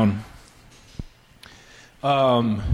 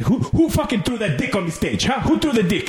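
angry mafia boss; 6 semitones higher than usual. Retold or imitated. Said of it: "He's like".

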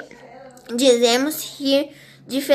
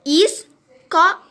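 drawn-out, higher and louder escola; slow example word; 4 semitones higher, 5 dB louder, slower than usual. Shown as a demonstration. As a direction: say escola slowly, syllable by syllable.

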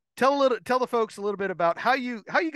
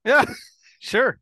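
laughing agreement; 6 semitones higher, 6 dB louder than usual. Real, not acted. Host laughing at guest's joke.

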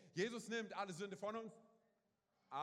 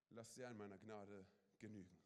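soft tender; 9 semitones lower, 7 dB quieter than usual. Acted. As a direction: lower and much softer, gently spoken. Repeats God's word softly.

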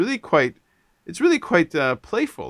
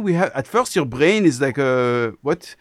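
Israeli-French accent; 3 semitones higher than usual. Shown as a demonstration. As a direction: put on a muddled French accent with an Israeli tinge. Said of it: "It is very strange".

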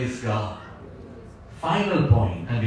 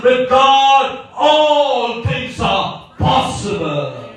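slow emphatic proclamation; 3 semitones higher, much slower than usual. Real, not acted. Preacher to congregation, slow and emphatic. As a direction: stretch every word of the promise out slowly. Preacher slows key line.

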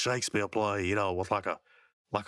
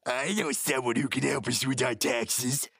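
slow put-on cartoon voice; Muscle Man; 2 semitones higher than usual. Grinning schemer volunteering to the boss, slow put-on voice. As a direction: imitate a devious schemer, drawn out. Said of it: "you see Muscle Man".

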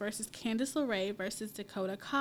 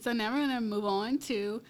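usual speaking voice

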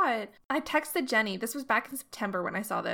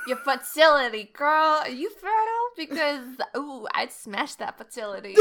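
teasing; 4 semitones higher, 6 dB louder than usual. Pretend. Teasing friend about accent.

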